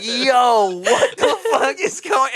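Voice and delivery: to a friend at the mic, high laughing disbelief. Retold or imitated. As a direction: crack up while asking.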